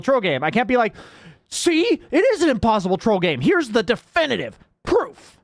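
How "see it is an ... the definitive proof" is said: high-pitched, hammed-up gotcha; mock triumphant. Streamer parodying proof of an impossible troll game, mock triumphant. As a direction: crow it as if proving a point, in jest.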